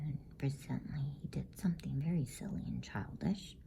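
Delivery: plain reading voice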